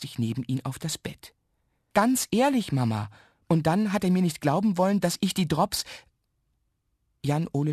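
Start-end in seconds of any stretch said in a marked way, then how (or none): 1.9-5.8 high, urgent confession; gushing. A narrator's reading. Boy explains everything to mother, gushing. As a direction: blurt it out high and eager. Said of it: "dann sprudelt alles aus ihm heraus".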